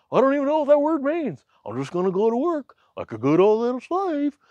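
high dopey voice mocking the herd; mocking clueless worker. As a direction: play someone who has no clue. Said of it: "They're like".

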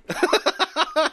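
real burst of high laughter; high, amused laugh. Real, not acted. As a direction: crack up at your co-host. Amused at dropped-out co-host's late return.

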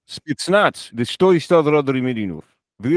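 mock Russian patter, run together; Russian mumbling. Shown as a demonstration. Cohost asks for his Russian, Russian mumbling. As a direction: mumble made-up words in a Russian accent. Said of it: "Just do Russian mumbling".

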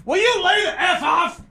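imitating a fed-up reporter losing it; angry outburst. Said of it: "turns around immediately and goes".

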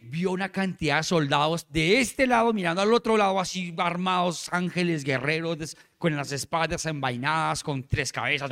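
ordinary delivery

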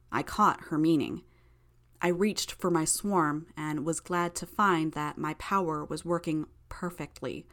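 ordinary delivery, nothing marked

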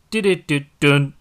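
loud, slow, punchy intro jingle; aggressive jingle. Acted. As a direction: belt it out like a show intro. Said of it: "See how aggressive that is?".

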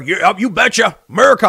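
lightly voiced folksy Trump guy, proud; a hint of a beer-bellied patriot; imitating a Trump fan. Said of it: "I can walk up to him and be like".

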